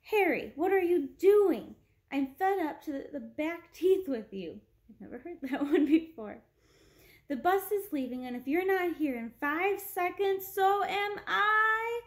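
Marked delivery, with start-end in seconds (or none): 0.1-4.6 high fed-up woman calling; exasperated woman. A narrator's reading. 7.3-12.0 high shrill call, rising to song; shrill woman calling. A narrator's reading. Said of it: "a woman called shrilly".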